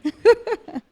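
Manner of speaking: short, high, loud laugh. Real, not acted. Pastor amused at her own retold reluctance, short laugh. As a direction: burst of laughter mid-story.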